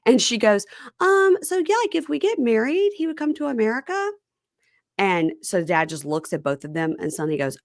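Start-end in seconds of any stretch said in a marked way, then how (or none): 1.0-4.1 high, girlish imitation of her apology; squeaky put-on voice of a nervous girlfriend; mimicking girlfriend. Retelling to co-host, imitating the girlfriend in a high put-on voice.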